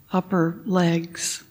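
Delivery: ordinary talk, nothing marked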